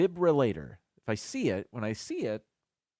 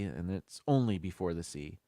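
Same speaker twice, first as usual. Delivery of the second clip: usual speaking voice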